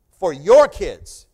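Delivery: put-on very loud, slow proclamation on "your kids"; loud, slow declaration of grievance; declaring. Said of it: "like he's declaring".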